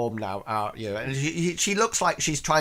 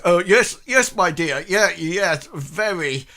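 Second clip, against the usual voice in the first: put-on lightly raised, loud old man voice; flustered old man; 6 semitones higher, 7 dB louder than usual. Old man Stanley flustered agreeing with his wife. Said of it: "then goes".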